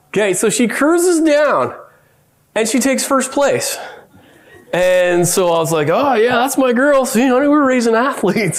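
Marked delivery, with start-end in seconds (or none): 5.9-8.6 higher-pitched mock bragging; retold boast, pitched up with pride; proud dad. Dad bragging to his wife after the win, proudly. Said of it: "I'm such a proud dad".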